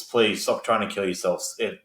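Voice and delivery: ordinary delivery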